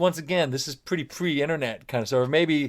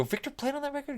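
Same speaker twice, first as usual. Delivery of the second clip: high-pitched retold disbelief; incredulous; 4 dB quieter than usual. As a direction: question it in high-pitched surprise. Retold surprise at friend's credit.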